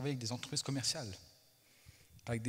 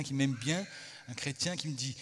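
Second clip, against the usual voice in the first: ordinary talk, nothing marked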